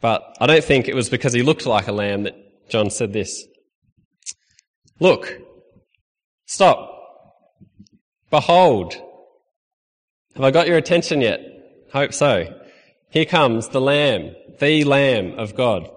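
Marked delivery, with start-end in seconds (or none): none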